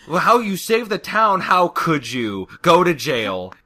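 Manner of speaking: loud, high start, scolding; hammed-up angry townsfolk; mock outrage. Acted crowd scolding the pony.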